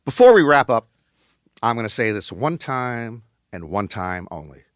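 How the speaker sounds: ordinary talk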